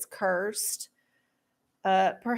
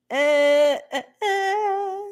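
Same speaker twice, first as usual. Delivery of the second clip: mock sobbing; 8 semitones higher, 3 dB louder, much slower than usual. Mock crying at Patrick.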